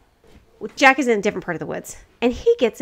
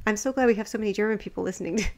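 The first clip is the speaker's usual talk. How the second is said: ordinary delivery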